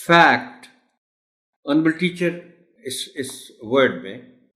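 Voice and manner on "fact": Fact. said loud and lengthened as a model word. Shown as a demonstration. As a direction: say Fact. clearly with a long a sound. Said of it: "words would require special attention in terms of articulation".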